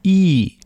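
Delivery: German long E vowel as in sehe; the E sound. Shown as a demonstration. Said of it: "Ich sehe has an".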